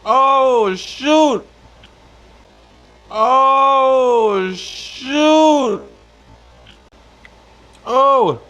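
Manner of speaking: high startled oh shoot; surprised yelp. Real, not acted. Bites hot fish, exclaims.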